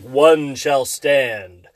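slow, low heroic robot leader; low, very slow solemn hero line; Optimus Prime voice. Retold or imitated. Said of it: "he says the coolest stuff".

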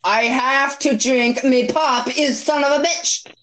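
hammed-up Irish accent, pitch climbing at the end; put-on Irish accent. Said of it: "Speak Irish".

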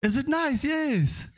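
high, light Nigerian voices trading a quick question and answer; Nigerian accent. Retold or imitated. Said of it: "about these Nigerians".

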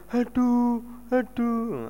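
a half-hearted imitated moan; understated pretend aduh; mild mock groan. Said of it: "apa gitu kamu?".

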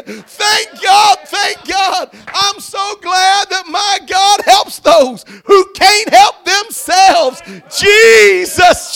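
high, loud shouts of praise with whoops; ecstatic preaching. Real, not acted. Preacher telling church about his healed arm, ecstatic shouting. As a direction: holler the testimony out at full voice. Testimony preached at full force.

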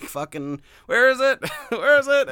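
high, loud exasperated yelling; rising frustrated shout. Retold or imitated. Frustrated player hunting lost bot.

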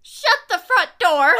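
loud, slow, mock outrage; shocked exclamation. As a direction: slam it out in disbelief. Reacting to missing cookie.

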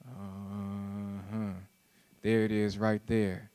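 low, soft, intimate preacher voice; hushed preaching. Acted. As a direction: draw them close, quietly. Quiet low murmur of finding the point.

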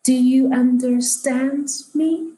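put-on elderly woman. Elderly woman asks puzzled strangers, slow and high. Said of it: "And she pauses".

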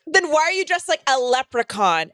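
put-on taunting girl voice; popular girl. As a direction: imitate a popular girl roasting a classmate. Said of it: "And she's like".

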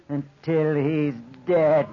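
very slow, deliberate threat; slow menacing. Acted. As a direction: drag out each word. Maniac savoring the threat.

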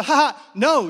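amused laughing refusal. Retold or imitated. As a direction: laugh off the request.

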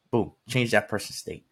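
ordinary delivery

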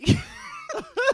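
high laughing shriek; a sudden shrieking laugh. Real, not acted. Laughs at the filming claim.

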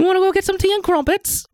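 high, hammed-up bad British accent. Imagined Emma Watson inviting him out, bad British accent. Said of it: "I have a very bad British accent".

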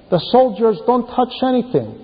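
normal talking voice

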